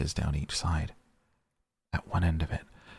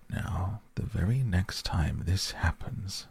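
ordinary delivery

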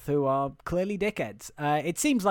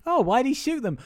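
higher, mock-concerned voice; acted shocked question; 9 semitones higher than usual. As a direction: ask it high, in mild mock dismay. Acted dialogue with the boy.

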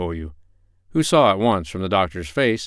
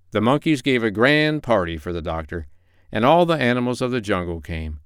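plain reading voice

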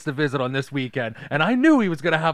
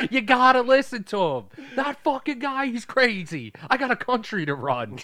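high, louder cranky rant; mock exasperated president; 10 semitones higher, 3 dB louder than usual. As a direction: complain like a harried boss. President ranting about kid.

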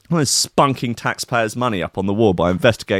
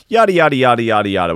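dismissive yada; 5 dB louder, faster than usual. Real, not acted. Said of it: "We know all of this".

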